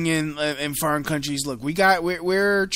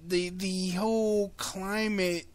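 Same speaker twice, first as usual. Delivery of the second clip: ordinary delivery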